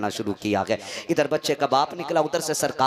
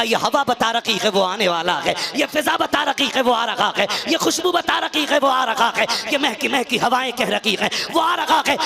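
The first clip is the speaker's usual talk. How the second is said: building, louder and higher preaching climax; impassioned preaching; 11 semitones higher, 8 dB louder than usual. Acted. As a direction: cry it out to the crowd, rising and rising. Preaching climax at full force. (जोशीले अंदाज़ में ऊँची होती हुई तक़रीर)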